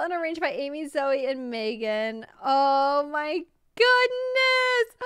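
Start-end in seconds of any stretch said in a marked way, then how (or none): 2.4-5.1 high, loud, slow drawn-out cry of amazement; excited shout. Real, not acted. Streamer overwhelmed by the build, shouting in delight. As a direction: stretch it out in disbelief.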